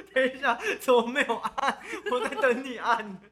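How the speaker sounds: high, loud, laughing through words. Real, not acted. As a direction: giggle while teasing. Laughing back at co-host.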